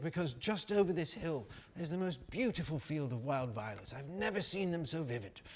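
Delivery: high, enthusiastic mock British rambler; excited British accent. British rambler describing a field of violets, excited mock British accent. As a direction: gush in a put-on British accent. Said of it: "he was very excited about these flowers".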